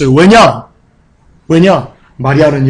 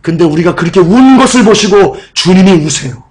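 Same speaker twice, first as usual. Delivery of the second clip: normal talking voice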